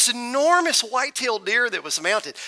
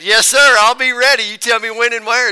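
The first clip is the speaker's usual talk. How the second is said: put-on louder, higher, eager to please the old man; eager yes sir; 4 semitones higher, 8 dB louder than usual. Accepting the fishing invite from Mister Applin, eager and loud. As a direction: snap to it like a keen recruit.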